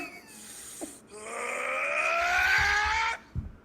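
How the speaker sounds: laughing build into a screaming power-up; charge-up laugh-scream. Acted. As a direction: let a laugh explode into a battle scream. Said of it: "Super Saiyan charge up for this one".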